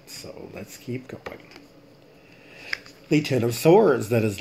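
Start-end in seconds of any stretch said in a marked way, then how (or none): 0.1-1.5 slow, softer whispered aside; hushed slow whisper to self. Real, not acted. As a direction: breathe it to yourself and move on. Aside during tarot reading.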